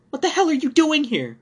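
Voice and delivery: high, loud furious yell; wife screams at the intruding husband; shouting wife. A narrator's reading. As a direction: scream it at him in fury. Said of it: "my wife shouted at me".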